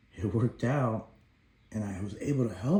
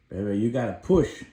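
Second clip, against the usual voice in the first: firm urging push; coaxing his baby girl to push, louder; 8 dB louder than usual. Retold or imitated.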